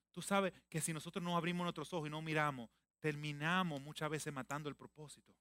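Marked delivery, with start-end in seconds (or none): none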